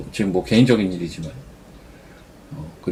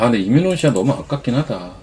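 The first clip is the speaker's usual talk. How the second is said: ordinary delivery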